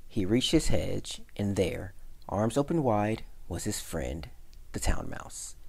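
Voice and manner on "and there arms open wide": ordinary delivery, nothing marked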